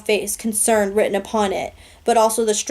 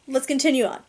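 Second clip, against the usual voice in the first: higher, teary voice pulling itself together; tearing up; 4 semitones higher than usual. Real, not acted. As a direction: gather yourself through real tears. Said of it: "I'm tearing up".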